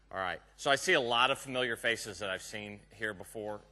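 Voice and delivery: usual speaking voice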